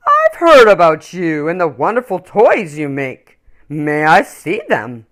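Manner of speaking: a big man greeting warmly; kindly big man. Acted.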